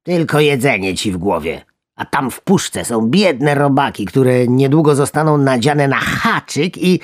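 high, indignant frog voice; rising outrage over the worms; reproachful frog. Acted. Big frog lectures little one about the poor worms. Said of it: "rzekła większa z potępieniem".

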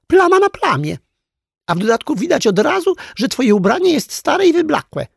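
high, loud, haughty scolding; scornful princess. A narrator's reading. Princess doll belittling the shabby clown, scornful. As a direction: berate him in a high princess voice. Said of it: "prychnęła księżniczka".

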